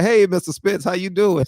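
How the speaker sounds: high-pitched, over-polite greeting; meek, eager-to-please high voice; upright and deferential. Acted. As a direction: snap to attention for a feared elder. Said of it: "I be so upright".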